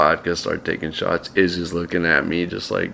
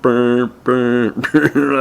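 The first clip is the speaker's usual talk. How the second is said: mock landline buzz, higher; imitated dial tone; 5 semitones higher, much slower than usual. Dead landline, imitating its buzz. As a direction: hum the dead line. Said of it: "The landline was like".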